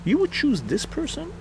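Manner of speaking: very high-pitched, outraged question; incredulous. Real, not acted. Challenging anyone who would choose Clinton, incredulous. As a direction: throw it out in sheer disbelief.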